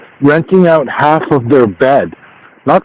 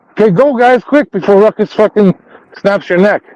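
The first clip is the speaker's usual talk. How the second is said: yelling while running away; 6 semitones higher than usual. Real, not acted. Warning others to flee dog.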